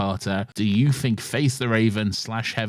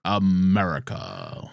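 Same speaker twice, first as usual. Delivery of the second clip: slow, drawn-out fake American villain accent; fake Mandarin voice; 4 semitones lower, slower than usual. Echoing co-host, he drawls "America." in the fake Mandarin accent. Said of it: "fake Mandarin".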